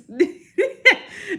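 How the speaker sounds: high burst of real laughter. Real, not acted. Laughing at her own impression of the coworkers. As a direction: laugh high and loud at your own joke.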